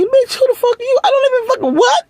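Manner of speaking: very high, squeaky disbelief; high-pitched mock outrage; incredulous squeal. Her reaction to his kiss request.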